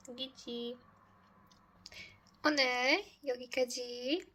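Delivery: usual speaking voice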